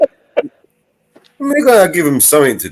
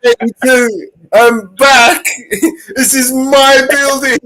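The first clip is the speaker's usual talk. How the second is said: megalomaniac rant; 12 semitones higher, 6 dB louder, slower than usual. Acted. Mock cult leader impression.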